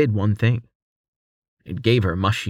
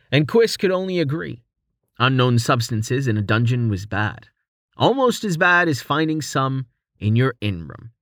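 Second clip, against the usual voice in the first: ordinary delivery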